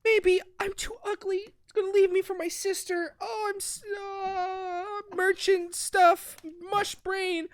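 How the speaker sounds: high, soft whiny inner monologue; mock self-pitying whine. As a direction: whimper it softly, words dragging and falling apart. Voicing heroine's self-pitying muddled thoughts.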